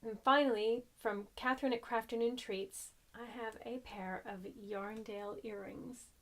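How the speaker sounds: ordinary delivery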